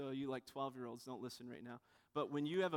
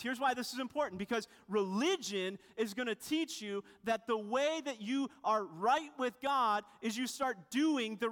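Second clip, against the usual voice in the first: higher, louder sermon drive; fervent preaching; 6 semitones higher, 4 dB louder than usual. Acted. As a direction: preach it with rising conviction. Preaching at full force.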